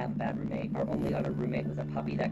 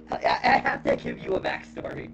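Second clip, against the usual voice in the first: still laughing; 9 dB louder than usual. Real, not acted. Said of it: "made you laugh so much".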